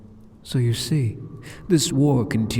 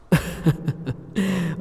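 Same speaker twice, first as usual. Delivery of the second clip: a higher teasing giggle; amused laugh; 6 semitones higher than usual. Acted. Demon lord amused by the hero's fear, teasing laugh. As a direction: laugh down at the little lamb.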